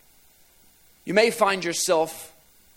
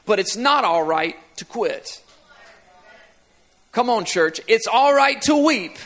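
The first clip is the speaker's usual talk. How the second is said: preaching at full force; 3 semitones higher than usual. Acted. Preacher rallying the church with "come on, church", full-force preaching. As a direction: build the refrain and rally the church. Preacher builds refrain with congregation.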